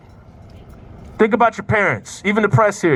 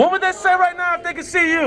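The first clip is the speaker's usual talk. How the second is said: high, projected speech over chanting; rally call; 8 semitones higher than usual. Real, not acted. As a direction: call out over a singing crowd. Calls out over the crowd's chant.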